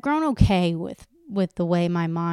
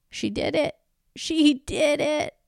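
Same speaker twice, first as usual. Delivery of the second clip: proud cheer; 7 semitones higher than usual. Real, not acted. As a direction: cheer her on from the heart. Cheering the woman on.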